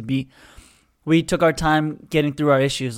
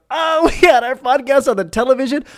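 laughing surprise; 9 semitones higher, 4 dB louder than usual. Retold or imitated. His reply on hearing it was on TV.

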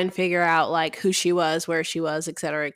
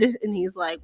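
ordinary talk, nothing marked

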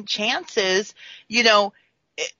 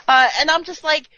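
normal talking voice

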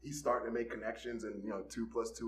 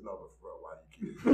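dead serious; 4 semitones lower, 10 dB quieter, much faster than usual. Retold or imitated. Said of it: "he looks me dead in the fucking face".

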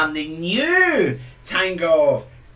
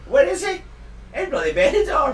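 indignant; 11 semitones higher than usual. Real, not acted. Indignant punchline.

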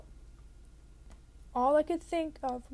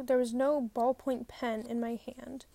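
plain reading voice

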